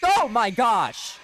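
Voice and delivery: high, drawn-out shocked cry; startled scream. Real, not acted. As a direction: yelp in fright. Jumpscare scream in horror game.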